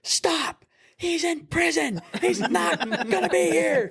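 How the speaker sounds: high, slow imitated snap from a fed-up mother; mom snapping. Mother silences her drunk daughter, high slow imitated snap. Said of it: "the mom finally just, like, snapped".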